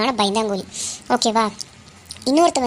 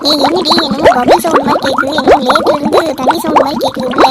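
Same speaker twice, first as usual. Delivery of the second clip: loud, startled alarm; 8 dB louder than usual. Acted. Girl hearing strange water sounds at night, loud alarmed fright. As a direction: blurt it out in fright.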